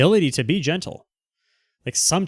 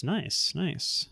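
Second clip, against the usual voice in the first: much softer, rising "nice, nice"; murmured nice; 3 semitones higher, 11 dB quieter than usual. Real, not acted. Streamer reacting to a viewer's sexy comment, murmured. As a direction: say it under your breath, amused.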